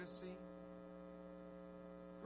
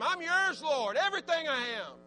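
1 fervent prayer; 10 semitones higher, 9 dB louder than usual. Acted. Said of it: "Tell Him".

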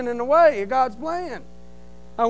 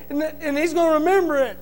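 preaching at full force; 4 semitones higher, 9 dB louder than usual. Acted. Telling the church about forgiveness, loud fervent preaching. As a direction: drive it home to the congregation.

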